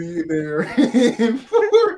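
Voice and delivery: laughing. Real, not acted. Laughing joke reply.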